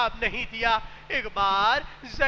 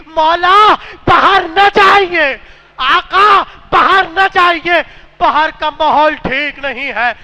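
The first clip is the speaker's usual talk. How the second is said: loud weeping plea, pitch up; crying plea; 3 semitones higher, 16 dB louder than usual. A narrator's reading. Old woman begs her master to stay, loud weeping plea. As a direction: beg him through tears not to go. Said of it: "رو کر کہنے لگی".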